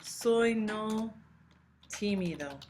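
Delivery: wrong word order. Shown as a demonstration. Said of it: "this is not right".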